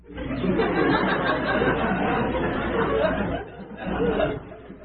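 long breathy high laugh; helpless laughter at own foolishness. Real, not acted.

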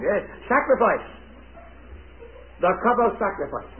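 ordinary talk

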